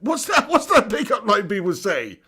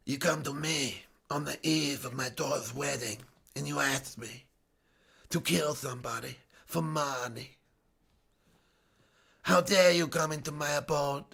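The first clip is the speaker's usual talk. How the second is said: Marlon Brando as the Godfather; 6 semitones lower, 9 dB quieter than usual. Acted. Streamer as the mafia boss reproaching a visitor, low hushed menace. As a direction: speak very low and soft, with long pauses. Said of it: "my request of Marlon Brando".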